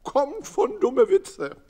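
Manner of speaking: put-on high, loud, slow old uncle; Uncle Fritz. Uncle Fritz declaring his verdict, high and slow. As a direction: proclaim it slowly as Uncle Fritz. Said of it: "Onkel Fritze sprach".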